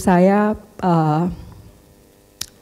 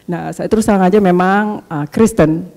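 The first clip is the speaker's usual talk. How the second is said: ordinary talk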